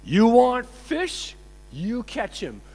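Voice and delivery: loud, high, drawn-out question, then dropping; mock exasperated challenge. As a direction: throw it back slowly, as a scolding dare. Acts out babaji defying his deity.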